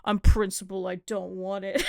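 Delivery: lightly voiced gruff refusal; imitating dad. Imitating dad refusing the vanilla Frosty on principle. Dad's stubborn reply.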